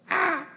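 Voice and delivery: small high-pitched pretend scream; a held-back imitation of a terrified shriek; mock scream. Preacher quoting Matthew on the frightened apostles, soft mock scream. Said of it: "And they screamed".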